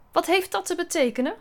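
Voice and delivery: cold demand for an explanation; icy. A narrator's reading. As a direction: ask it frostily, controlled. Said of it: "vroeg juf Trudy ijzig".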